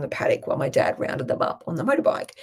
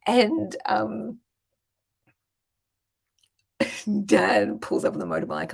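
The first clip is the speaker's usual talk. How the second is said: ordinary talk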